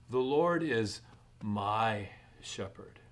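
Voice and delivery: "The Lord" pushed up, "my shepherd" drawn out slowly; emphatic, slowed statement. Shown as a demonstration. Pastor stresses the verse's claim to the congregation, with "my shepherd" slowed. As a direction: lean on "Lord", then stretch "my shepherd" slowly. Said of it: "makes an emphatic statement".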